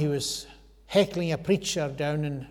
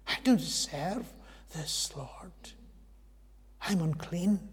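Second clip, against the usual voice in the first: awed whisper; 6 dB quieter than usual. Acted. Isaiah's awed confession to God.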